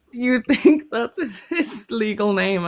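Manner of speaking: high, slow and laughing through the line; laughing quote. Retold or imitated. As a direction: giggle out the other person's question. Retelling a silly misunderstanding.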